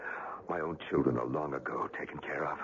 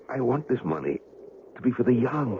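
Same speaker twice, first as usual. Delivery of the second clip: urgent plea; 9 semitones higher, 4 dB louder than usual. Acted. Dying man's last wish.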